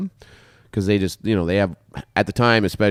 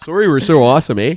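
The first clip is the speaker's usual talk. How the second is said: higher, louder mock Canadian boast; hammed-up Canadian; 4 semitones higher, 4 dB louder, faster than usual. Friend teasing co-host in a mock Canadian voice. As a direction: play up the proud Canadian, eh on the end.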